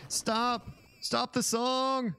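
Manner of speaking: high-pitched slow villain cry; villain yell. Acted. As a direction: scream it like a beaten alien. Villain yelling in pain.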